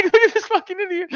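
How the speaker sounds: very high, laugh-choked mockery; almost crying laughing. Laughing at the furious husband, near tears. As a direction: squeak it out, cracking up. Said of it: "tears almost laughing at how angry he is".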